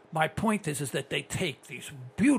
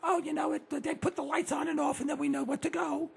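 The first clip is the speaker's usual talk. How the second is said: put-on high little-kid voice, explaining innocently; put-on high kid's singsong; granddaughter's voice; 10 semitones higher, 5 dB quieter than usual. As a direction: imitate a child, high and singsong. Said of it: "She goes".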